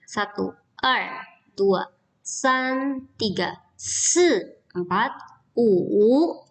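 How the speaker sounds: slow counting with each tone drawn out; slow clear Mandarin counting. Shown as a demonstration. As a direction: model the tones slowly for the class. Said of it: "kalian harus hafal ya".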